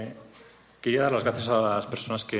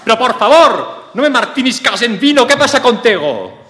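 hammed-up outraged shout; 12 semitones higher, 11 dB louder than usual. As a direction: blow up in mock outrage. Acted outburst after slap.

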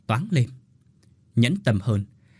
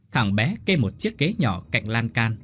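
ordinary delivery

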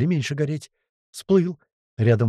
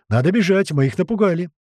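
urgent shout; 6 semitones higher, 4 dB louder than usual. A narrator's reading. Urging flight from bees.

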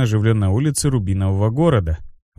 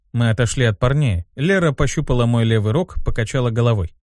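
ordinary delivery

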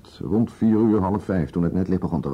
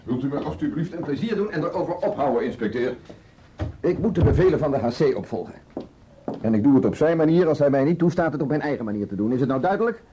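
higher, sharp exasperated rebuke; angry; 6 semitones higher than usual. Acted. Commissaris ordering the inspector to stop nagging, angry higher rebuke. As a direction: shut the argument down, fed up and commanding.

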